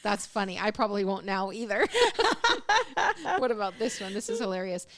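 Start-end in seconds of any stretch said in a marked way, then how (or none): 1.9-3.3 bright high-pitched laugh. Real, not acted. Laughing at a joke.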